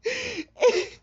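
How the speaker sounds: real tears breaking through bitter laughs; crying through laughter. Real, not acted. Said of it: "what you're listening to right now is an episode".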